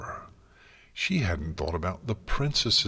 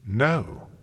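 sudden louder, higher refusal; reflexive rebuke; 5 semitones higher, 5 dB louder than usual. A narrator's reading. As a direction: cut them off with a commanding no. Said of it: "Celestia's reflexive rebuke".

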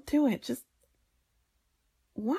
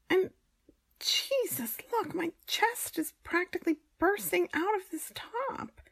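shocked; 11 semitones higher than usual. Acted. Shocked at revealing top.

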